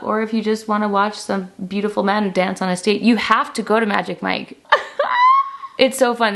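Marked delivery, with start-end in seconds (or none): 4.6-5.7 real laugh, pitched way up; high squealing laugh. Real, not acted. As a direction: burst into a high, giddy laugh. Laughs after urging Magic Mike visit.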